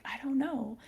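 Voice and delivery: very soft whispered answer; whispered reply. Retold or imitated. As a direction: imitate a friend whispering back. Friend's retold whispered reply.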